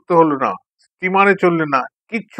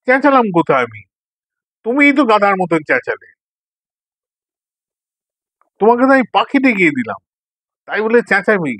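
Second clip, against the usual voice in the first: indignant protest; 6 semitones higher than usual. Acted. Quarrel over who shouted.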